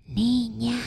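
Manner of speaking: soft breathy call; whisper. Acted. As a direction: whisper the girl's name from the tree. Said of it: "le susurró".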